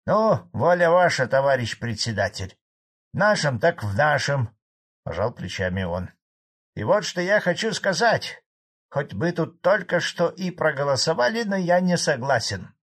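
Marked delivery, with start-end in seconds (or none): 0.0-4.5 loud, higher farmer giving in; grudging concession. A narrator's reading. Farmer yields to the chairman's correction, grudgingly. 6.7-12.7 higher, emphatic peasant objection; dissenting old farmer. A narrator's reading. Old farmer objects at the meeting, raised insistent voice.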